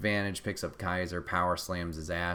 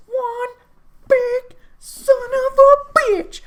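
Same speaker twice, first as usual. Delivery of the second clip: much higher and louder, slow drawn-out yell; big hype yell; 13 dB louder, much slower than usual. Real, not acted. Fan hyping the huge wrestler, slow high roar. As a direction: roar it out like an announcer hyping a monster.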